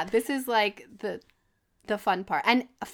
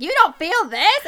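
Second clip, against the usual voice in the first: raised, louder disbelief at her calm; incredulous; 7 semitones higher, 7 dB louder than usual. Retold or imitated. Friend piercing an ear, shocked she feels no pain, incredulous. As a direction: ask it high, can't believe she feels nothing.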